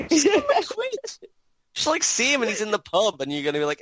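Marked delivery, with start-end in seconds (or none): 0.1-0.9 high, loud, pleading insistence; insistent high shout. Acted. Repeated louder higher.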